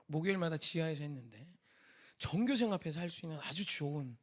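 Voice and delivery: usual speaking voice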